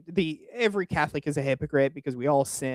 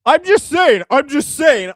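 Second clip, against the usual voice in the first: much higher and louder, a sneering parody of his excuse; loud mocking echo; 8 semitones higher, 9 dB louder than usual. Streamer mocks the guest's excuse back at him: loud sneering echo.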